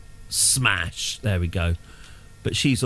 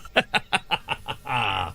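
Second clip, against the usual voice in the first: relieved laugh. Real, not acted. After the messy landing.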